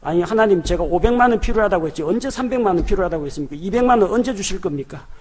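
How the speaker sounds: quick, pushy, indignant bargaining; a mock insolent complaint to God; rude demanding prayer. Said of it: "무례하게 기도하는 거".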